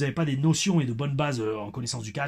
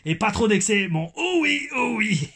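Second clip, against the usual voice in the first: high-pitched mock Québécois accent; 7 semitones higher, 3 dB louder, slower than usual. Reading a fan's holiday advice, high Québécois accent. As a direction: squeal the catchphrase in put-on Québécois. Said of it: "Mon accent québécois".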